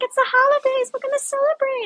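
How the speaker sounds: high, gleeful mock cheer; festive squeal. Toasting with her sister over champagne, high gleeful cheer. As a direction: chirp it like a party girl. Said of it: "'cause we're like".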